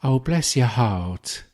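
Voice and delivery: slow, lightly put-on kind English lady; a gentle mock of a kind English lady, drawn out slowly; a mild imitation of a patronising English woman. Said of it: "And she just said".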